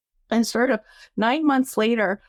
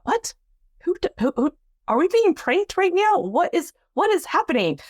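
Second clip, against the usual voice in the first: incredulous surprise; 8 semitones higher than usual. Retold or imitated. Disbelief at manager's offer.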